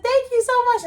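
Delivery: high, loud delighted thank-you; excited thanks. Retold or imitated. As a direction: beam out the thanks. Thanks after mom's compliment.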